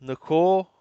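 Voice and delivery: ordinary delivery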